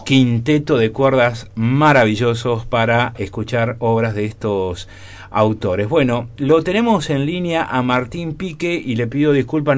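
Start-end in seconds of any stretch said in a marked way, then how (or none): none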